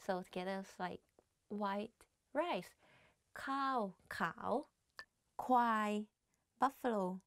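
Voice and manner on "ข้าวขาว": ข้าวขาว with falling tone on ข้าว then rising tone on ขาว; Thai tones. Shown as a demonstration. (ข้าว falling tone, ขาว rising tone)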